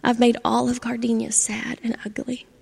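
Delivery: whispered confession, barely voiced; ashamed whisper. Charlie confessing to the oak tree, whispering in shame. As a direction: let it sink into a guilty whisper.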